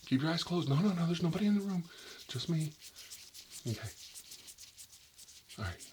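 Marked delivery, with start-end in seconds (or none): 0.1-2.7 urgent reassurance. Acted. Reassuring patient during eye test.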